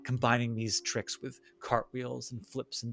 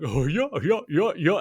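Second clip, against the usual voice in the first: goofy clown voice; 5 semitones higher, 5 dB louder than usual. Acted. Clown acting out goofy antics.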